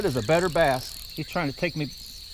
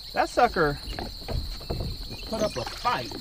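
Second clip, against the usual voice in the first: ordinary talk, nothing marked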